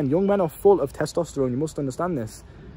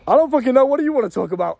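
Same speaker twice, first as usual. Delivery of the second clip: exasperated outburst; 9 semitones higher, 5 dB louder than usual. Real, not acted. Forgetting his point, exasperated.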